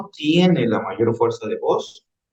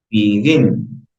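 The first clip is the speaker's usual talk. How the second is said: "Begin" with the weight on GIN; stress on last syllable; 3 dB louder than usual. Shown as a demonstration. Teacher giving an example of final stress, "Begin" stressed on GIN. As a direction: push the second syllable of "Begin". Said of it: "la mayor fuerza de voz en la última".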